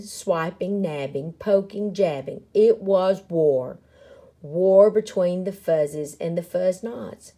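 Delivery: ordinary delivery, nothing marked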